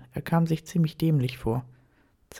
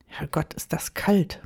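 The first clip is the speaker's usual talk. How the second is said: muttering. A narrator's reading. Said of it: "murmelte Daniel".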